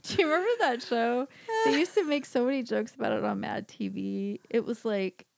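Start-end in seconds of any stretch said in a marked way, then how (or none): none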